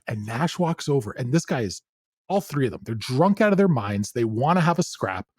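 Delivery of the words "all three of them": normal talking voice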